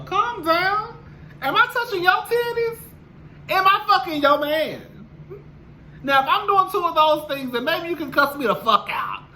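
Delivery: high, sassy, defiant; sassy clapback. Real, not acted. YouTuber telling off commenters, in a high sassy voice. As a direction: snap back at the haters, high and sassy.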